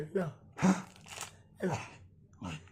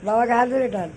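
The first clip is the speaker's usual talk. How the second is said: loud coaxing; 2 semitones higher, 7 dB louder than usual. Real, not acted. Owner urging pet to tug sleeping dad, loud coaxing call. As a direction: call out brightly to get the pet moving.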